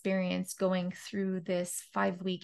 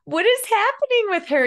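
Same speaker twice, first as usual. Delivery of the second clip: much higher, louder, incredulous onlooker; mock bystander; 12 semitones higher, 11 dB louder than usual. Joking about dancing naked on the mountain, in a loud squealing mock voice. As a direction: squeal it like a baffled neighbour.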